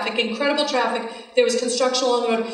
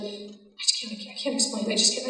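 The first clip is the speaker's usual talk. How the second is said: hushed helplessness; 6 dB quieter than usual. Retold or imitated. Upset woman cannot explain.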